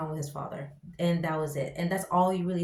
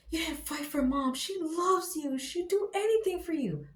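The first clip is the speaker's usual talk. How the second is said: guilt-trip your father; mock son scolding dad; accusing son; 9 semitones higher than usual. Voicing the son's reproach.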